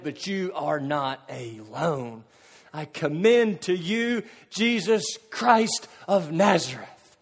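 ordinary talk, nothing marked

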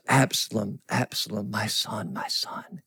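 falling to a broken whisper; a father's grief breaking into a hush; grieving father. Retold or imitated. David mourning his son, grieving whisper. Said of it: "And he's broken and grieving".

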